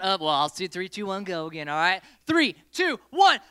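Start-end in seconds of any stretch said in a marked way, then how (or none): 2.3-3.4 high loud countdown; shouted countdown. Real, not acted. Host restarting the game with a loud countdown. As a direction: call the start at full voice.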